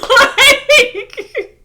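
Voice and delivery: loud, high burst of laughter; cracking up at the story. Real, not acted. Host laughing at the comeback she just retold, high laughter.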